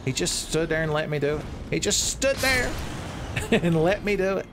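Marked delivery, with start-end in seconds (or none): 1.7-4.5 much higher, amazed, breaking into a laugh; can't believe your luck; incredulous laugh. Real, not acted.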